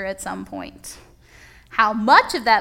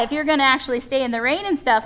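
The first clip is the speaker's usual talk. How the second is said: normal talking voice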